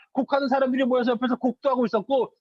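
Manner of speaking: ordinary talk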